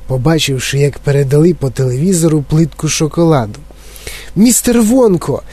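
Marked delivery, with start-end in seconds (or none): 4.4-5.4 put-on high eager boyish call to Mr Wonka; excited cry. Boy shouting eagerly to Mr Wonka. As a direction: call out to him, thrilled. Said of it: "вигукнув він".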